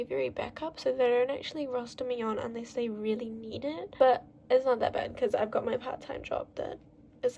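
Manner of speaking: normal talking voice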